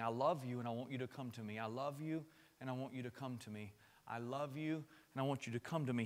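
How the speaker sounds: softly repeated call; tender invitation. Retold or imitated. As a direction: plead gently, lovingly. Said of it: "He's saying".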